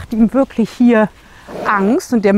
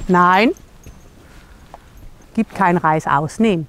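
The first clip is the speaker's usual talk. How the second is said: ordinary talk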